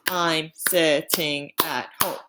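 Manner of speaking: slow, syllable by syllable; counting syllables slowly. Shown as a demonstration. Teacher counts the syllables in "I'm sitting at home", slowly. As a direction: tap out each syllable slowly. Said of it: "That's five syllables".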